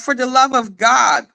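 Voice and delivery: usual speaking voice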